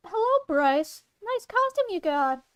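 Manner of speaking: high-pitched friendly greeting voice; character voice. Acted. Greeting Bryce about his costume, high character voice. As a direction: lift the pitch to admire.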